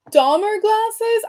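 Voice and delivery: high, slow disbelieving read; shocked reading a rude comment; incredulous. Real, not acted. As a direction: stretch it out in disbelief.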